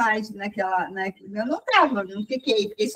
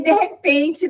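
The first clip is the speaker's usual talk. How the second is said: usual speaking voice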